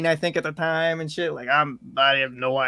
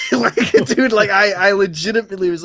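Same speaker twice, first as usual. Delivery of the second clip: laughing; 6 semitones higher, 7 dB louder than usual. Real, not acted. Cracks up while telling story.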